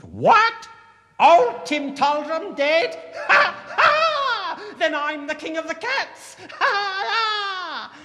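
very high, piercing triumphant shriek; shrieking cat. A narrator's reading. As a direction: shriek and cackle like a cat claiming a crown. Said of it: "Tom shrieked out".

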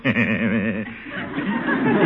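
long, trailing chuckle; helpless chuckle. Acted. Uncle cannot stop finding the houseboat funny, helpless chuckle. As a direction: let the chuckle bubble back up. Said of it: "Excuse me for laughing".

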